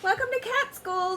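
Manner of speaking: high, bright welcome from a big cat; cheery teacher cat. Acted. Big round cat teacher welcoming the class, cheery. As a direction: greet the new class cheerfully. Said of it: "said a big round cat".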